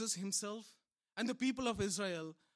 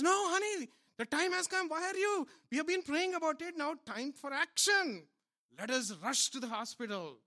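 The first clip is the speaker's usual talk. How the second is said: much higher, pleading wife's voice; exasperated wife; 7 semitones higher, 4 dB louder than usual. Acted. Wife hurrying her praying husband to the hospital, high and exasperated. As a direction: urge him out the door. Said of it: "So the wife says".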